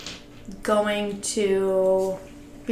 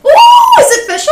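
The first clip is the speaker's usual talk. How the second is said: squealing with delight, high and loud; thrilled shriek of joy; excited; 15 dB louder than usual. Real, not acted. Friend hears of new relationship.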